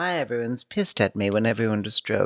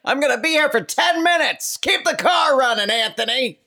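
high, loud, brassy housewife yelling orders; brash mob wife; 13 semitones higher, 5 dB louder than usual. Acted. Yelling at her driver Anthony, high loud brash wife. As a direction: bark at Anthony like a tough Jersey wife.

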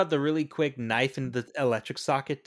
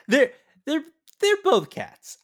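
mock echo; 12 semitones higher, 6 dB louder than usual. Echoing friend's line mockingly.

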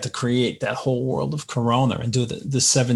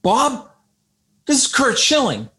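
excited caller; 11 semitones higher than usual. Retold or imitated. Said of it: "He goes".

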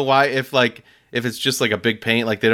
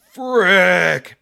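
"Frick" stretched out loud and higher; drawn-out exclamation; 5 semitones higher, 4 dB louder than usual. Retold or imitated. Customer finding his order short, long exasperated "Frick". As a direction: imitate a frustrated man drawing out "Frick". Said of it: "but not frick, but, "Frick".

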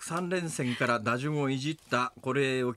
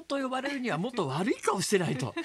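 amused retelling; 8 semitones higher than usual. Retold or imitated. Retelling Emoto's verdict on Hara.

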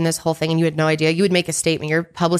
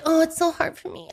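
high whiny self-pity; mock whining; 10 semitones higher, 3 dB quieter than usual. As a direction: whine it like a spoiled rich wife. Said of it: "she's like".

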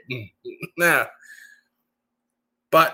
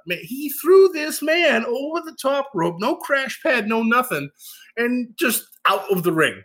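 excited; 10 semitones higher than usual. Real, not acted. Hyping the big throw.

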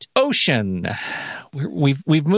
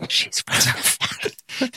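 whisper; 11 semitones higher, 4 dB quieter than usual. Real, not acted. Host whispering before the round.

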